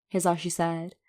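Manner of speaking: ordinary delivery, nothing marked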